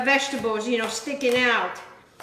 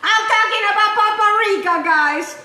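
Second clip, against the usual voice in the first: louder, laughing through the joke; joking laugh; 6 semitones higher, 3 dB louder than usual. Real, not acted. Host joking with viewers about smoke, laughing loudly. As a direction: crack yourself up at the pun.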